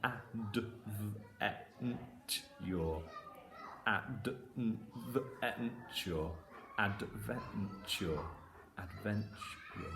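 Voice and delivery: sounds stretched out slowly, then joined; slow blending. Shown as a demonstration. Teacher slowly blending the word for the class. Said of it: "I'll go through it slowly".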